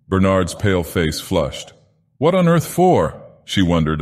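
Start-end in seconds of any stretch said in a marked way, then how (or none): 2.2-3.2 sharply higher, astonished question; genuinely taken aback; higher astonished wonder. Acted.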